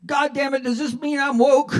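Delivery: high, loud exasperated cry; dismayed outburst. Acted. Old patient finding out he is woke, exasperated. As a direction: blurt it out in horrified realisation.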